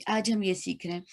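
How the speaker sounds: ordinary talk, nothing marked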